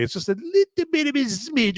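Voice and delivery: "smidge" lifted high and leaned on; stress on smidge. Shown as a demonstration. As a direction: hit "smidge" up high to make it sound tiny. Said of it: "I stress the word smidge".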